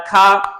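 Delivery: British-style "car" with no R at the end; silent final R. Shown as a demonstration. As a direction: end "car" on an open vowel. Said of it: "silent the R in the final position".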